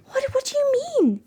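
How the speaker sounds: high, incredulous question; squeaky what-do-you-mean reaction; mock disbelief. Real, not acted. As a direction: jump up in pitch in disbelief. Reacting to chat comment.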